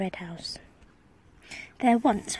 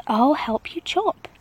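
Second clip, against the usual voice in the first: a hint of a bold king, louder; helpful king; 4 semitones higher, 6 dB louder, slower than usual. A narrator's reading. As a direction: offer help with gusto. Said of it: "said the hungry king".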